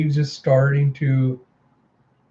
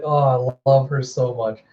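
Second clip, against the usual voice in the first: ordinary delivery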